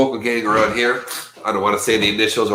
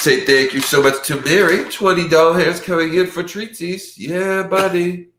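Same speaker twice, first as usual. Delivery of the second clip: hype shout-out; 8 semitones higher than usual. Real, not acted. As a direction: pump up the chat for Mary. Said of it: "hyping a donation".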